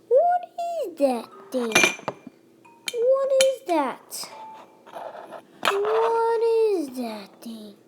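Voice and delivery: high, drawn-out, sing-song questioning; drawn-out exclaiming. Pretend. Player gawking at a mystery thing, drawn-out exclaiming. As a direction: stretch each word out high in exaggerated wonder. Escalating playful demand about mystery object.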